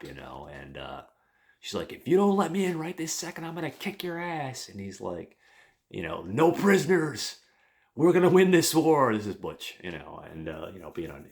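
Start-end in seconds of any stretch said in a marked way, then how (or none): 1.9-4.7 put-on high, fast, loud threat from a girl; enraged younger sister, much higher pitch; furious sister. 6.2-9.1 put-on high, loud war whoop of a little kid; little brother shouting his battle cry.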